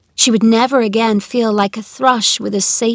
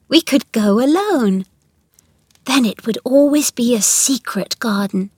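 put-on higher, hopeful girl's voice; persuasive young girl; 6 semitones higher than usual. A narrator's reading. Mary suggests going alone with Colin, hopefully persuading. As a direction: coax him gently as an eager girl.

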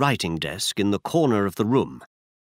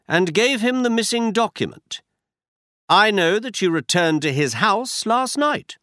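brisk, higher-pitched detective voice; Holmes voiced higher; 6 semitones higher than usual. A narrator's reading. Holmes tells Watson where they go, higher detective voice. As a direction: voice Holmes up high, assured.

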